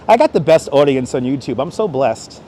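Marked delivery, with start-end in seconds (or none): none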